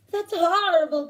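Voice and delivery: loud, crowing exclamation; crowing rooster. Acted. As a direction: crow it out like a rooster. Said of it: "crowed the rooster".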